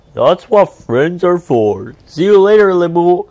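a big whale waving goodbye; hearty booming farewell; cheerful whale. A narrator's reading. Whale speaks.

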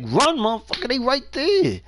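high, urgent mock yell; urgent yell at the screen. Yelling at slow Jason player.

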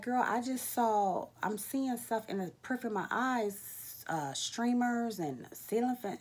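wide-eyed, spelling out the strange sight; alarmed. Retold or imitated. Retelling panic to coworker.

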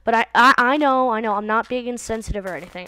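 normal talking voice

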